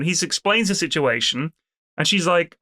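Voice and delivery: ordinary delivery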